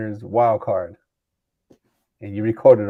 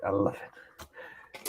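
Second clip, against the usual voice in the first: whispering; 3 semitones lower, 14 dB quieter, much slower than usual. Acted. Whispered echo after loud line.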